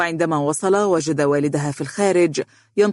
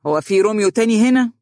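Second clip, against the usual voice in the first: higher-pitched sarcastic comeback; derisive, pitched-up jibe; mocking; 5 semitones higher, 4 dB louder than usual. A narrator's reading. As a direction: taunt him with the question. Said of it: "قال نادر متهكما".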